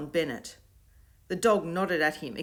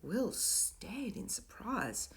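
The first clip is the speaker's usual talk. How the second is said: ordinary delivery, nothing marked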